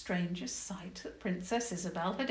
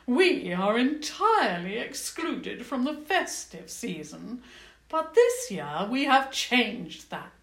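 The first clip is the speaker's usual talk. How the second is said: goblin queen; 5 semitones higher, 6 dB louder than usual. Acted. Queen speaks.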